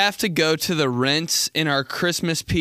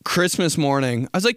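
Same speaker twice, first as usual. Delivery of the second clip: ordinary talk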